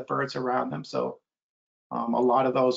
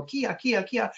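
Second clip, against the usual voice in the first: hawk call; 7 semitones higher, slower than usual. Retold or imitated. Imitating red-shouldered hawk call.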